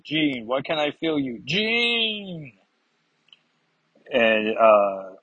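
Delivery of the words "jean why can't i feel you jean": acted anguish rising to a high wail; anguished cry. Scott can't feel Jean any more, anguished calling out. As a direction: build to a long, high cry of her name.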